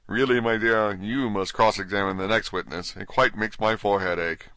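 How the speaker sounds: usual speaking voice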